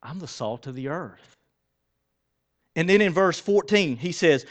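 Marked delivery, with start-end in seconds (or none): 0.0-1.4 soft inner voice. Pretend. Believer quietly telling himself who he is, soft. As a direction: say it quietly to yourself. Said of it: "have that inner voice that says".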